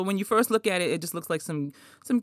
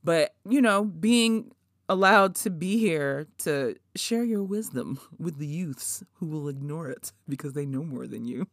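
ordinary talk, nothing marked

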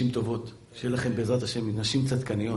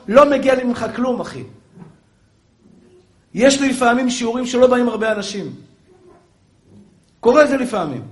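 loud, higher-pitched sermon delivery; impassioned preaching; 9 semitones higher, 8 dB louder than usual. Acted. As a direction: preach it forcefully to the congregation. Preacher at full force.

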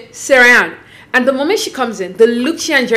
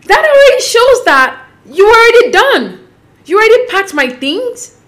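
high, slow, louder outrage; outraged; 9 semitones higher, 4 dB louder than usual. Real, not acted. Vlogger outraged on Laura's behalf, slow and high.

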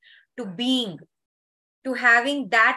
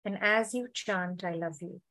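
guided meditation; 2 semitones lower than usual. Acted.

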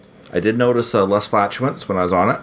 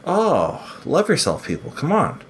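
exasperated plea; 7 semitones higher, slower than usual. Real, not acted.

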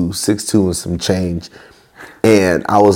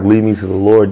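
ordinary delivery

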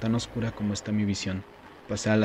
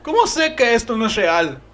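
panicked; 14 semitones higher, 8 dB louder than usual. Acted. Panicked self-questioning.

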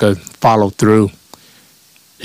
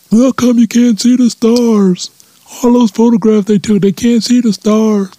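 mock hoax believer; 13 semitones higher than usual. Comedian parodies a moon hoax believer, high mocking voice. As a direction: whine it out in a high cartoon voice.

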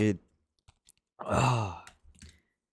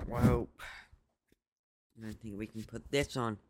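usual speaking voice

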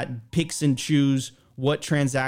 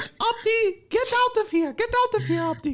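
much higher, dismissive falsetto; high mock woman voice. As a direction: shoo him off in a squeaky voice. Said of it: "And she's like".

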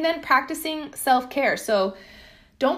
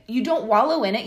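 ordinary talk, nothing marked

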